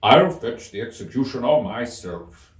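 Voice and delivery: eager King. A narrator's reading. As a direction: hurry off keen to help. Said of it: "said the King eagerly".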